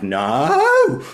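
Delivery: long, very high, loud mock cry; exaggerated imitation of an outraged tutor; Andrew Davidson. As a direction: wail "no" in hammed-up protest. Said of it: "one time he genuinely did this".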